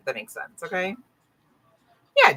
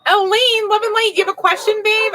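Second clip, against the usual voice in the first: bright greeting; 9 dB louder than usual. Real, not acted. Greeting viewer in sing-song.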